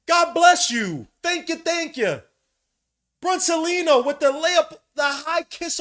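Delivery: overjoyed fan yelling praise; ecstatic hype. Real, not acted. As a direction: rave about him like he's unreal. Fan hyping a great layup.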